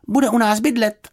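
high, loud proud announcement; announcing. A narrator's reading. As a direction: tell the news with pride. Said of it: "oznámila Juditka".

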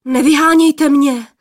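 high, loud startled begging; frightened plea. A narrator's reading. Startled young man begging the sisters to let him stay, high and loud. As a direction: beg them not to send him away. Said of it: "lekl se poplašený mládenec".

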